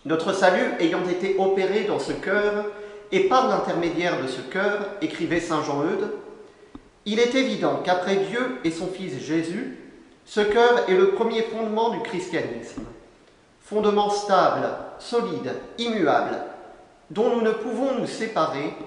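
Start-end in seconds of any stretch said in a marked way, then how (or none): none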